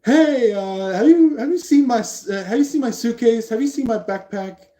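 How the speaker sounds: high, loud put-on voice of someone barging in; intruder walking in. Person walking in on a near kiss, high and loud. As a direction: burst in asking for a lost bag, high and loud. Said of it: "somebody walks in going like".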